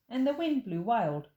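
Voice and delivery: ordinary delivery, nothing marked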